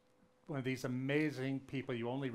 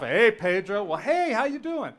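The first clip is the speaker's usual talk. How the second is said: high, loud, laughing mock greeting; laughing tease; 9 semitones higher, 12 dB louder than usual. Old country men teasing the little boy, laughing. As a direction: chuckle it out like amused old men. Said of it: "these old country guys would laugh".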